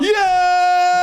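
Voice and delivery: high, loud triumphant shout; mock cheer. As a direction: cheer like a winner. Cheering imagined Benidorm reveal.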